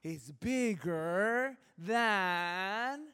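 very slow, stretched-out mother teaching a verse; wife slowly teaching a four-year-old a Bible verse; drawn-out teaching. Retold or imitated. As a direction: spell it out slowly for a toddler. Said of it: "she's doing, like".

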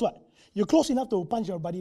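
normal talking voice

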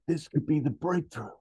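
high-pitched hopeful announcement; excited pitch. Acted. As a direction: sell it as a huge discovery. Scientist pitching discovery to board.

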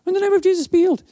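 high-pitched quick echo of the congregation; imitated group response, pitched right up; crowd repeating a prayer. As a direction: parrot it back in a high, small voice.

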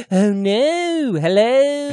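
high, drawn-out mock Liverpool vowels. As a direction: stretch each word up high. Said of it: "[name] would be like".

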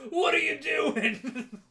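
slow, high, loud mock shout. Paulie yelling at his sister Adrian, slow mock shout. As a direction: bellow it like a brute, half laughing. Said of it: "He's like".

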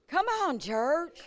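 high exhorting cry to the church; rally the church with a high call; fervent call. Real, not acted. Preacher rallying the congregation with a high call.

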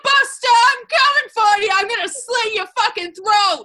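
menacing threat. Acted. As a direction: snarl it like a mobster. Threatening Buster.

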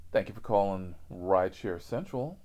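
usual speaking voice

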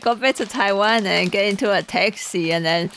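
normal talking voice

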